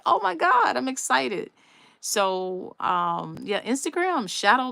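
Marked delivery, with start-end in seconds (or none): 0.0-1.5 high-pitched replay of a thrilled outburst; excited. Retold or imitated. Vlogger retelling her thrill at learning something new, excited. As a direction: re-enact your own giddy moment, pitched up. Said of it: "I got, I got excited".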